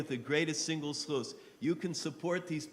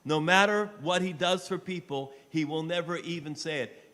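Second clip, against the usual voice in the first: loud, high pleading fundraiser voice; loud charity appeal; impassioned appeal; 5 semitones higher, 7 dB louder than usual. Real, not acted. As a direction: preach it with rising fervor. Fundraising appeal at full force.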